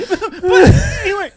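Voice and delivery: high, loud and slow through laughter; hammed-up high voice, laugh-choked; laughing mock voice. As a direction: squeal it out while cracking up. Acting the held friend while cracking up.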